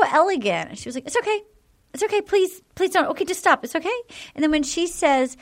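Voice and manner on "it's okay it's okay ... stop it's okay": higher, gentle imitation of Catherine pleading. Retelling Catherine comforting the man.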